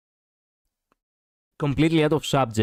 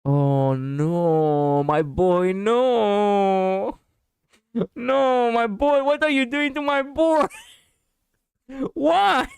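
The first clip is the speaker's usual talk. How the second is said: high, drawn-out lament climbing higher; mock anguished wail; 9 semitones higher, much slower than usual. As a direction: wail in hammed-up distress for your favorite. Mock-anguished fan reacts to character's fate.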